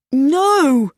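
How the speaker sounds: high cry of disbelief and fear; alarmed cry. A narrator's reading. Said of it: "cried Chowder".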